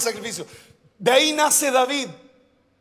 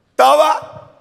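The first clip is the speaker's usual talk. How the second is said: emphatic shout; 5 semitones higher, 6 dB louder than usual. Real, not acted. Pastor quizzing congregation, answers himself.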